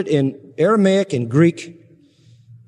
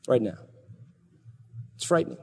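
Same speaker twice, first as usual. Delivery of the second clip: much softer, lower, grave; hushed; 6 semitones lower, 10 dB quieter, much faster than usual. Real, not acted. Preacher warns listeners of remorse, in a soft grave hush. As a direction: let it fall to a hush.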